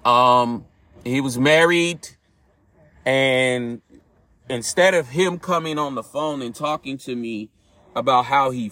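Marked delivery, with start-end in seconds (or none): none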